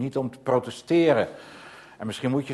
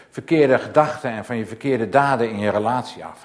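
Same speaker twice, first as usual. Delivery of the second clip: loud, driving sermon delivery; preaching at full force; fervent preaching; 8 dB louder than usual. Acted. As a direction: hammer each line home to the congregation. Preaching about God knowing all.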